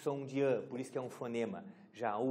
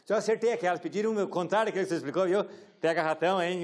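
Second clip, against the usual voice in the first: mock taunting student; 3 semitones higher, 6 dB louder, faster than usual.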